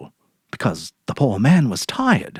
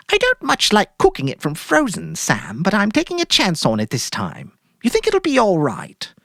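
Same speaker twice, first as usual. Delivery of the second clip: much higher, airy put-on cheer; forced cheerful wife; 10 semitones higher than usual. Mary ordering groceries from Sam, falsely cheerful. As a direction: play it breezy to hide guilt.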